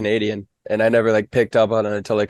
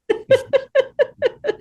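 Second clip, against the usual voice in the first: high laugh. Real, not acted. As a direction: burst of high-pitched laughter. Big shared laugh.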